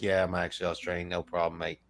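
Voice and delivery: lightly voiced, low and soft unbothered reply; easygoing Kiwi. Kiwi mistaken for an Australian, lightly played easygoing tone. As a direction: shrug it off quietly, a hint of mellow Kiwi. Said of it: "don't care if they're called Australian".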